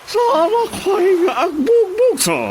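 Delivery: hammed-up blubbering complaint; mock sobbing. Stranger acts upset, sobbing.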